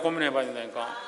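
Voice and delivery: soft, sinking afterthought; quiet echo. Real, not acted. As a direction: let the echo drop to a murmur. Echoed softly after first take.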